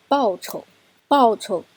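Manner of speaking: 报酬 stressed on 报, 酬 short and light; 报 carries the stress, 酬 barely touched; neutral tone demo. Shown as a demonstration. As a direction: say 报酬 with a quick light 酬. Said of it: "你的重音是在报上面".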